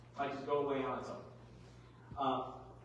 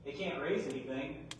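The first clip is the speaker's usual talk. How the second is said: normal talking voice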